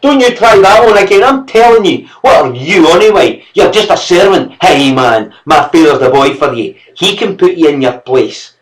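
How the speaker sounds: put-on high, loud taunting child; a brat daring the servant to touch him; cheeky boy. Boy taunting the servant with his father.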